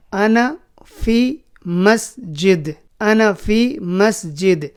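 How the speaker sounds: very slow, each word drawn out; slow demo. Shown as a demonstration. Teacher slowly modelling "I am in the mosque". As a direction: stretch انا فی مسجد word by word. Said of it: "میں مسجد میں ہوں".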